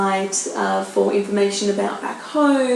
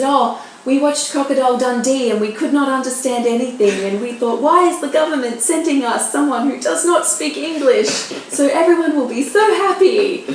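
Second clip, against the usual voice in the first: higher, emphatic retelling in her voice; animated quote, rising higher and louder; imitating co-teacher; 4 semitones higher, 4 dB louder than usual. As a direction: act out a baffled, then delighted teacher. Acts co-teacher's relieved explanation.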